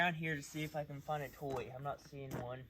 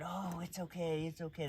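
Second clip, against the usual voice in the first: soothing owner; 5 semitones higher, 3 dB quieter than usual. Retold or imitated. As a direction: lightly play the coddling owner. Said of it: "you can't comfort him by being".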